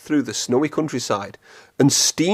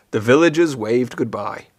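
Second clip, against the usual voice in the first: plain reading voice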